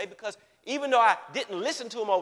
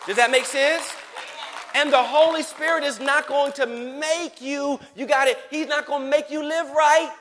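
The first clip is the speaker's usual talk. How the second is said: louder, higher, building preaching; full-force preaching; 5 semitones higher, 6 dB louder than usual. Acted. Preacher pressing the congregation, loud and fervent. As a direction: drive the point home to the congregation.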